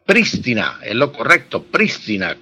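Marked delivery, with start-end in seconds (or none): 0.1-0.8 "Prístina" with the stress on PRÍS; esdrújula stress. Shown as a demonstration. Professor corrects for listeners, "Prístina" stressed on first syllable. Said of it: "con acentuación esdrújula". 1.7-2.4 "prístina" stressed on PRÍS, dropping away lower; esdrújula stress. Shown as a demonstration. Professor repeats the correct form, "prístina" stressed on first syllable. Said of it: "con acentuación esdrújula".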